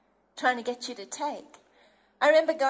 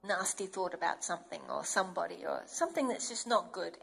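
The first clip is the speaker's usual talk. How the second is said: ordinary delivery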